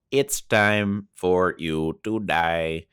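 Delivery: very slow robot voice; the Brain. Acted. The villain threatening the heroes, slow robotic voice. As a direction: drag out each word like a robot villain. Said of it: "I'm gonna be doing that voice a lot".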